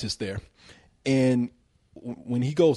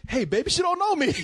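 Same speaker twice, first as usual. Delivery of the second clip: much higher, delighted call to a buddy; high, giddy mock delight; gleeful guy; 12 semitones higher than usual. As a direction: crow it like you just got lucky. Gleeful husband calling out.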